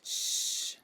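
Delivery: unvoiced whispered shush; hush. Louise hushing Cook to stop her saying the word, whispered. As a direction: hush her gently under the breath. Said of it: "said Louise".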